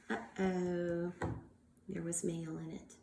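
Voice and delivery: soft, low uh-oh; hushed uh-oh. Acted. Teacher to class, a hushed uh-oh. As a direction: drop it low for suspense. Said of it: "suspense aside to children".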